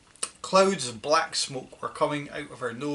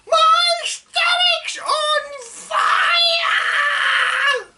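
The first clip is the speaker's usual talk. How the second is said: very high, loud, drawn-out wailing shriek; shrieking old woman; 9 dB louder, much slower than usual. Acted. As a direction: howl it out like a burning old crone. Grandma shrieks after medicine.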